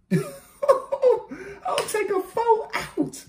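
high, slow, laughing through the quoted bar. Real, not acted. Quoting the bar back to the viewers, laughing high. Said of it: "That was beautiful".

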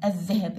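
الذهب with a doubled dh and no L; silent lam. Shown as a demonstration. Teacher repeats the word for pupils, lam silent. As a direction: say الذهب as "adh-dhahab", pausing between repeats. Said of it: "اللام ما لفظت".

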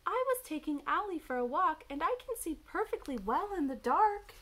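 high, loud little owl; indignant, swooping storybook owlet; Baby Owl protesting. A narrator's reading. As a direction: argue back in a high owlet voice. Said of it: "said Baby Owl".

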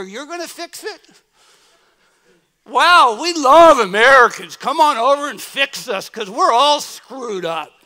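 loud, high, sneering fake welcome; mock the locals mocking the newcomer, loud and biting; sarcastic mockery. Locals jeering at the American missionary, loud sarcastic sneer.

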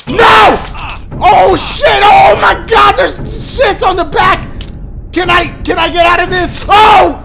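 high loud frantic yells; panicked shouting. Real, not acted. Gamer facing a second boss, shouting in panic. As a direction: panic as the new boss attacks.

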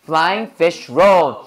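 "Flying fish roll" slow, a nod's beat on each word; three stresses. Shown as a demonstration. Teacher asking for three nods, three clear stresses. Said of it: "고개를 세 번 움직여야 돼".

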